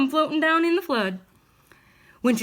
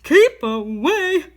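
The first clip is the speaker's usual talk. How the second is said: bear crying out; 5 dB louder, much slower than usual. A narrator's reading. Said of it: "the bear cried".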